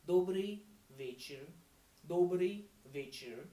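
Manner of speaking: slow demo. Shown as a demonstration. Teacher modelling добрый вечер slowly for learners. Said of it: "Good evening is добрый вечер".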